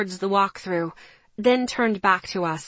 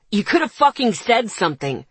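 loud angry shout; 5 dB louder than usual. Acted. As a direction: shout it at her. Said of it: "he shouted".

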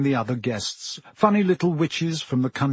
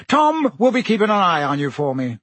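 jovial Minister voice; 4 semitones higher, 4 dB louder than usual. A narrator's reading. Fudge voiced by narrator.

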